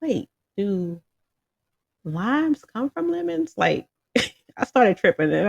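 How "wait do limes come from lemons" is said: sudden high, puzzled question; wide-eyed wondering aloud; surprised double take. Retold or imitated. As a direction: catch yourself in a confused double take. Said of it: "I was like".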